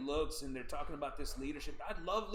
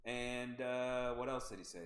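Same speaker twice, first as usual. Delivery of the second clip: normal talking voice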